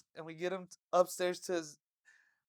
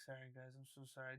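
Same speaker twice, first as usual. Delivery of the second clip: put-on drunk mumble; 7 semitones lower, 22 dB quieter, faster than usual. Drunk friend apologizing.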